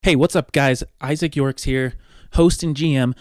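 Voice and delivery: ordinary delivery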